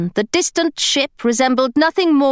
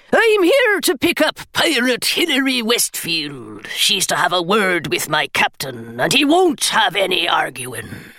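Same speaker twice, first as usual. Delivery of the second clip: pirate voice; 4 semitones lower than usual. Acted. Pirate sailor character voice.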